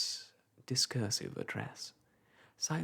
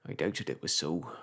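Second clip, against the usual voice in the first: chatty old countryman, lightly voiced; a touch of a gossiping old clerk; old village clerk; 7 semitones lower, 3 dB louder than usual. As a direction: ramble on like a garrulous old parish clerk. Macey's voice again.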